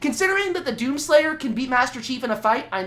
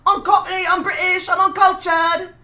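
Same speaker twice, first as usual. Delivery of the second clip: mock Cockney; 8 semitones higher, 5 dB louder than usual. Streamer boasting to chat in mock Cockney accent. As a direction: ham up a Cockney accent as a bit. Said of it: "I'm still doing this voice".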